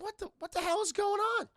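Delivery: high-pitched, loud bewilderment; baffled outcry. Retold or imitated. Gargoyles left behind by the vanishing villains, baffled high cry. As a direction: voice leaps up in mock disbelief.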